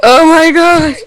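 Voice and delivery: high, helpless laughter through the words; real cracking up, near tears; laughing. Real, not acted. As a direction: gasp it out laughing. Cracks up at Angry Scot ringtone.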